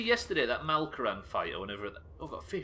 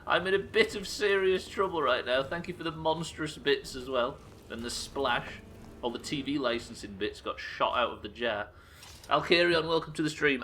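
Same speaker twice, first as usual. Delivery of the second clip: usual speaking voice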